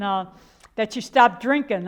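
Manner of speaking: ordinary delivery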